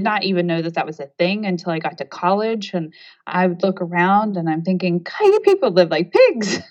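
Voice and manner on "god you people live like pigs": disgusted. Retold or imitated. Retold college thought about roommates.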